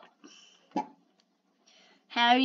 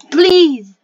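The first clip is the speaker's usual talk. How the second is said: loud desperate plea; 3 semitones higher, 14 dB louder than usual. Real, not acted. Frustrated plea while playing.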